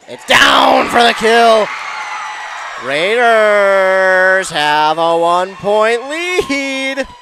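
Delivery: loud, very drawn-out sportscaster call; excited point shout. Real, not acted. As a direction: shout the kill, then stretch the lead out slowly. Commentator calls the go-ahead point.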